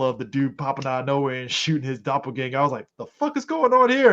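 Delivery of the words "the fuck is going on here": shocked outburst. Retold or imitated. Retold shocked reaction.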